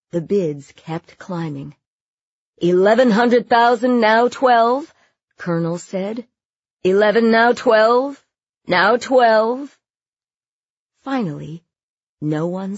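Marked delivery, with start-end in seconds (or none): 2.6-4.9 high, loud bid-calling; auctioneer's loud call. A narrator's reading. Auctioneer calls out the climbing bids, belting them loudly. 6.8-9.8 loud, insistent repeated bid call; auctioneer's loud call. A narrator's reading. Auctioneer presses silent bidders for more, calling out loudly.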